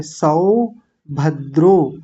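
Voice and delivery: सौभद्रो very slowly, सौ open and द्रो closed; slow teacher demo; slow syllables. Shown as a demonstration. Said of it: "देखिए ध्यान से".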